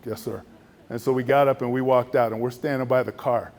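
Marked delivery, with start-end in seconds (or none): 0.1-0.4 much softer, lower, deflated; meek reply. Retold or imitated. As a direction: give in quietly. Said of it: "I was just like".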